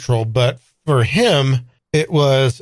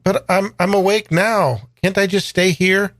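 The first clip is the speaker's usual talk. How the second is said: put-on much higher, faltering child voice; pleading young boy; 7 semitones higher than usual. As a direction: beg to stay like a little boy.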